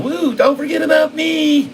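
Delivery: very high, squeaky little worm pleading; a tiny bait worm begging not to be forgotten. Pretend. Trader voicing the bait worm, very high and squeaky. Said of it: "and it's going".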